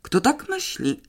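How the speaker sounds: high, sharp question; indignant question. A narrator's reading. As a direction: demand who would think that. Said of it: "spytała Emilia".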